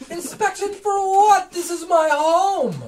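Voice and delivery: incredulous shriek at the intrusion; outraged wizard. Acted.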